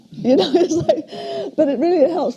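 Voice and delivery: amused laugh breaking into the words, then talking on through it. Real, not acted. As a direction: chuckle about the granddaughter naming it Bob. Granddaughter named her spot Bob.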